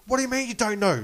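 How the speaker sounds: high, loud, incredulous comeback; mock angry retort. Wife challenging the waitress's denial, mock outraged.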